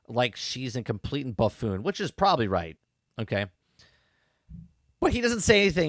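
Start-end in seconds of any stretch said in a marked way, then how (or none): none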